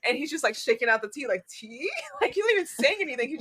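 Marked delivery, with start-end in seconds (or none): 1.5-1.9 soft offer with rising tune; questioning. Retold or imitated. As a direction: hold out the word as a gentle question. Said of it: "like, a questioning sound".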